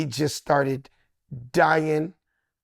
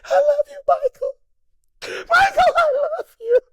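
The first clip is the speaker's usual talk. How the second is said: high, sobbing, tearful cry of love; high crying wail of "I love you, Michael"; crying declaration; 4 dB louder, slower than usual. Retold or imitated. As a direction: wail it through tears, overwhelmed. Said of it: "I went right into his ear. I was like".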